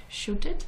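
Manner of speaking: "shoot it" said with long oo as in boot; unsure "shoot", rising like a question; wrong past tense. Real, not acted. Guest checking the English past tense, saying "shoot" with a long oo.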